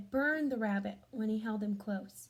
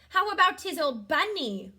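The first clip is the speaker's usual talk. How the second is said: Nana's voice; 6 semitones higher, 10 dB louder than usual. Acted. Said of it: "Nana asked".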